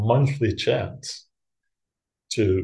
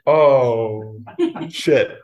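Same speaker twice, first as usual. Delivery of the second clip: drawn-out, louder "oh", then the curse; mock dread at being recognized; comic dismay; 2 semitones higher, 4 dB louder than usual. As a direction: let the realization sink in, comically. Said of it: "And I was like".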